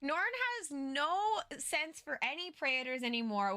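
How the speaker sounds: normal talking voice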